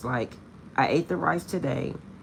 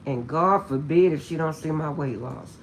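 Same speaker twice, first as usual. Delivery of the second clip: ordinary talk, nothing marked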